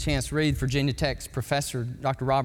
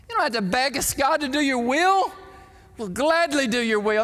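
eager yes to God; 8 semitones higher than usual. Pretend. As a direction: rise into a passionate promise to God. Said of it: "We'd say".

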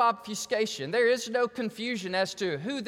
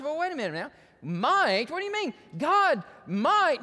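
higher, puzzled objection from the pews; imagined doubter; 7 semitones higher, 3 dB louder than usual. Retold or imitated. Pastor voices a congregant objecting to the word might, mock-puzzled and higher. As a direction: voice the listener's skeptical question. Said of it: "You say".

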